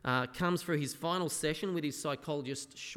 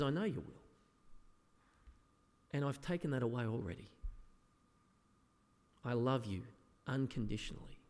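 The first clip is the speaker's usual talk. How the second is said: softer, gentle voice of a loving Jesus; tender reassurance; 4 semitones lower, 5 dB quieter than usual. Retold or imitated. Jesus gently assuring believers of his love, softly tender. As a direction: soothe a doubting child.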